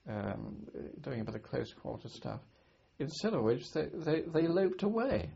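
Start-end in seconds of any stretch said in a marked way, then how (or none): 3.0-5.3 higher voice breaking into laughter; laughing as you recount it; amused, laughing. Real, not acted. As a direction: tell it through chuckles, tickled by the absurd sight. Amused by enemy running away.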